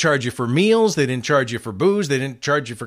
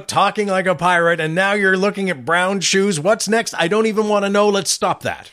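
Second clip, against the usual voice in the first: a hint of an exasperated program director, higher and louder; exasperated boss; 5 semitones higher, 3 dB louder than usual. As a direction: lightly play the fed-up boss scolding. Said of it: "who said".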